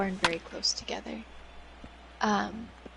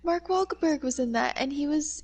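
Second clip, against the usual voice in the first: ordinary delivery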